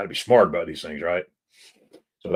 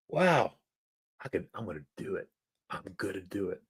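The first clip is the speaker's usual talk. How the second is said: much softer, quick self-talk; hushed resolve; 9 dB quieter than usual. Retold or imitated. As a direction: mutter the decision to yourself, fast and hushed. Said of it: "So I started saying".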